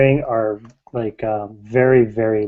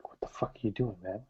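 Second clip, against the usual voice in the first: very soft, under-the-breath challenge; territorial look; 3 semitones lower, 18 dB quieter than usual. Acted. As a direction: warn off a rival almost silently. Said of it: "a look like".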